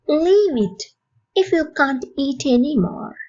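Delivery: slow, evenly spaced teaching delivery; slow model phrase. Shown as a demonstration. Teacher to English learners, slow model phrase. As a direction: say it slowly for learners to copy.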